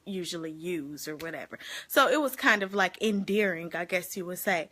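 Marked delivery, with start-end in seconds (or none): none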